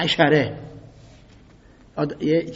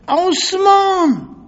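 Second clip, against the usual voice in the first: very high, loud, drawn-out wailing call; anguished slow wail of a name; crying out; 14 semitones higher, 7 dB louder, much slower than usual. Acted. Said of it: "داد زد".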